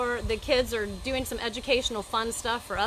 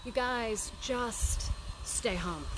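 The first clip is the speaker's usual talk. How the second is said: exasperated; 3 dB louder, slower than usual. Retold or imitated. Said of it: "and I'm like".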